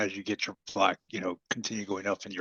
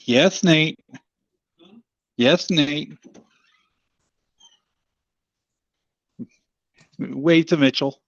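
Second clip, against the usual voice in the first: calling out; 7 semitones higher, 11 dB louder, slower than usual. Real, not acted. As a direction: call across the room. Calling out to her child.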